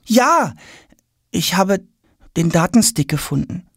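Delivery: put-on high, halting boy's admission; confessing boy. A narrator's reading. Tom owns up expecting a telling-off, hesitant. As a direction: confess with pauses between the words. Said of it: "gesteht Tom".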